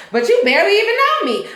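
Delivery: put-on a lightly done teen with attitude; mimicking attitude. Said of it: "he said it with an attitude".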